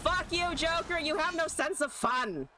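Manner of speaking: high-pitched indignant griping; exasperated high whine at an opponent; indignant rant. Real, not acted. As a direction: tell off a killjoy opponent, pitch way up. Cursing the opponent.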